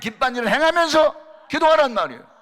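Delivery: shouted high exhortation; full-force preaching. Real, not acted. Preacher urging the congregation to act while praying, shouted exhortation. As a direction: preach it at the top of your voice.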